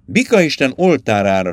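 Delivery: ordinary delivery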